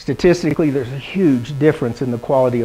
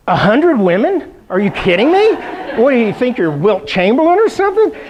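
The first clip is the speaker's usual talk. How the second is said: mock disbelief; 7 semitones higher than usual. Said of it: "I'm like".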